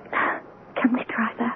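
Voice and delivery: slow, breathy whisper, barely audible. Acted. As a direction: breathe the question out slowly, almost no voice. Wife shaken after mother's death.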